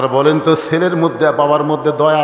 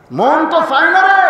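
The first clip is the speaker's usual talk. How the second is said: pleading outburst; 11 semitones higher, 4 dB louder than usual. Retold or imitated. Father's reluctant plea repeated.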